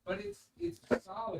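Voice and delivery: distant, off-mic voice; calling from another room. Real, not acted. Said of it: "but on mic".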